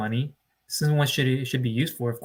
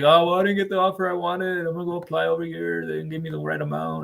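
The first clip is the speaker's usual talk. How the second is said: higher, mock-whining complaint; whiny client; 3 semitones higher than usual. Coach mimicking a complaining applicant, higher and whining. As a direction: imitate a client sulking over a loan offer. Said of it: "You're like, 'Oh".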